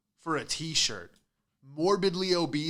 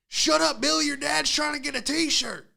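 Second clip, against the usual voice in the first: put-on higher, louder yell at the kid; mock parent yell; 13 semitones higher, 6 dB louder than usual. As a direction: snap at the kid to shut up. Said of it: "Meanwhile, you're like".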